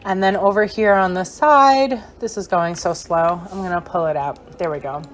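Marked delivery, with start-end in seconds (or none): none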